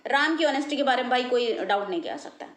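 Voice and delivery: normal talking voice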